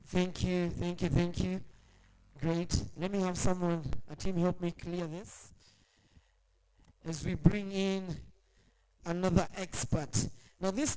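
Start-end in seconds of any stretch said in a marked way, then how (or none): none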